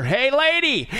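high, brash parrot hail; parrot calling out. Acted. Parrot calls to the lady after work, high and brash. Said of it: "the parrot says to her".

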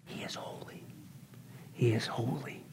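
hushed awed whisper; whispered holy. Real, not acted. Preacher whispering God's holiness after the rebuke. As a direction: let each word fall in reverence. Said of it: "repeated awed refrain".

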